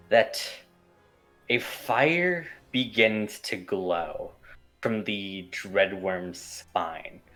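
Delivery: ordinary talk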